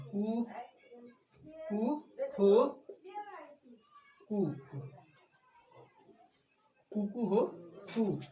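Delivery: slow drawn-out imitated cock-a-doodle; mock rooster crowing. Shown as a demonstration. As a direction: crow like a rooster at dawn, stretching the last note. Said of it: "इस तरह से कुछ बोलता है".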